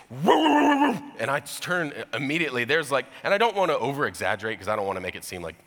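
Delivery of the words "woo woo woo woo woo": high, loud woofing bark; yelping woo woo of a big dog; imitated dog barking. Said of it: "I just hear this".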